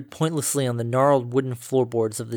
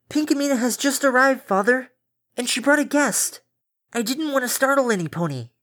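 put-on much higher young female voice; polite young daughter, voice pitched up; daughter's voice; 11 semitones higher than usual. Daughter's voice through the door.

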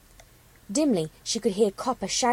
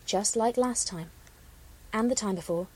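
ordinary delivery, nothing marked

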